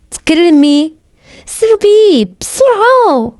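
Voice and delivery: high, drawn-out frightened demand; frightened urging. A narrator's reading. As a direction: urge her on in fear. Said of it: "وهو خايف".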